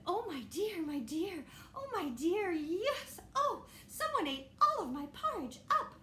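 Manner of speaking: high, fussing mother bear voice; a flustered mama bear, pitched well up; Mommy Bear. Acted.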